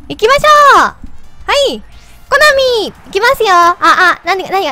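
chipmunk voice from helium. Real, not acted. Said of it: "自分で聞いてると声よくわかんない". (ヘリウムガスの甲高い声)

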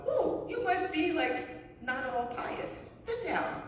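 high, surprised voice; playful mock of startled people; mock surprise. People reacting to a chaplain saying suck, mock surprised. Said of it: "people go".

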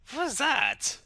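high, loud burst of disbelief; startled exclamation. Acted. Bystander asking about the phone call, startled. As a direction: react in loud, high-pitched alarm.

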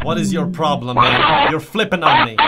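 loud exasperated protest; angry. Real, not acted. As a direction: raise your voice at the teammates. Arguing with hostile teammates.

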